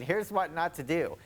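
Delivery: usual speaking voice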